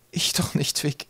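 a touch of a sob in the denial; sobbing. A narrator's reading. Mother upset, answering Twig, lightly sobbing. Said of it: "schluchzte sie".

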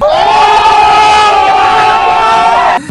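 long, loud high-pitched scream; real scream. Real, not acted. Gamer caught by a scare in the game, screaming.

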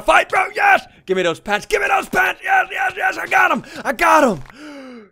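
high, loud battle yelling; excited shouting. Real, not acted. Player fighting another for his pants, excited shouting. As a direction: yell in triumph as you attack.

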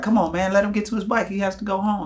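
high-pitched mock plea; retold pleading protest. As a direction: argue up high for a friend, retold. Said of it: "I'm trying to defend my friend".

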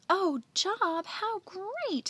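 very high, loud delight; thrilled. Acted. Mildred hearing of Andy's job, thrilled. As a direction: gush in a high excited voice.

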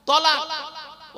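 তলাক drawn out very slowly, short o, high; slow tolak. Shown as a demonstration. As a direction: spell out তলাক slowly for the crowd. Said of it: "কি বললাম".

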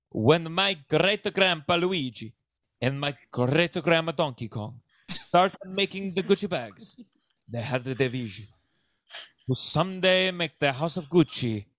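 hammed-up Italian patriarch accent; broad stage Italian accent; cartoonish Italian accent. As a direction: make a proud announcement in a broad fake Italian accent. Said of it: "speaks with an accent that".

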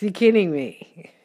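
loud, high disbelief; real surprise. Real, not acted. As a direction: blurt it out, amazed at the card. Reacting to drawn card.

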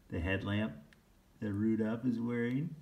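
plain reading voice

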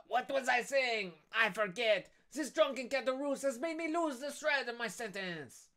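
high, exasperated character voice; irritated schemer. Acted. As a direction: complain in a high put-on voice. Acted Danglars losing his thread.

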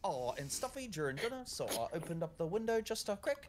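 put-on Irish accent. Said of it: "my Irish accent is as good".

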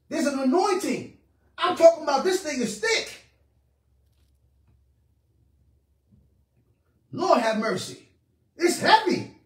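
high, urgent preacher's exclamations; overcome, impassioned preaching. Real, not acted. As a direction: cry out under the anointing. Preaches on the heavy anointing.

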